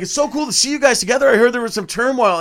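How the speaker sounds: chatty starstruck fan; excited fan. Retold or imitated. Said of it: "He's like".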